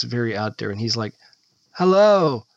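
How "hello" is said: higher, loud corny greeting; corny TV host. Retold or imitated. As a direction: greet like a cheesy travel host. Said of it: "he's very corny".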